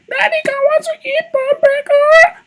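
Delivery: put-on very high, slow whiny kid voice; child's voice. Father imitating his child asking to go out, very high whiny voice. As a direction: whine like a little child asking dad. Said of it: "he even call me".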